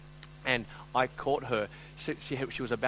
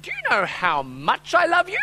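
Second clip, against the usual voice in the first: very high, loud child impression; slow, singsong question as a small girl; little daughter's voice; 11 dB louder than usual. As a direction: imitate a kid buttering up Dad. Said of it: "I hear this little voice in the back".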